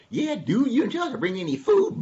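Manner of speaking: high, whiny hungry dog; dog character. Acted. Hungry dog complains to donkey, high whiny voice.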